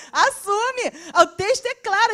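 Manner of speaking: high, half laughing, half crying outrage; laughing indignation. Real, not acted. Preacher fuming at Aaron to the church, laughing indignantly. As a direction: laugh in disbelief as you protest. Outburst over Aaron's cowardice.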